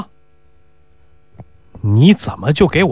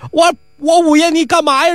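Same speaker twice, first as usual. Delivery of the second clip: 慌张求问; 12 semitones higher than usual. Acted. 囔鼻子慌张.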